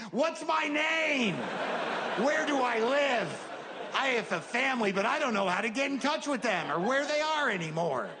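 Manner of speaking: put-on panicked elderly man, half shouting; lost confused old man. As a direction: wail it like a lost old man who forgot everything. Confused man lost without phone.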